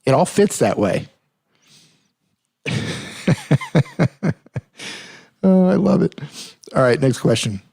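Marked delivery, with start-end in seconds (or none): 2.6-4.3 high, wheezy giggle; real tickled laugh. Real, not acted. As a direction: crack up in short high pulses. Amused by twang joke.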